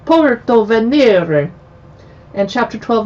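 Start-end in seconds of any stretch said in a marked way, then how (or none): 0.1-1.5 slow, stretched-out Italian accent. Shown as a demonstration. Host reading chapter names, showy Italian accent. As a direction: draw the name out in showy Italian. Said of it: "my Italian accent".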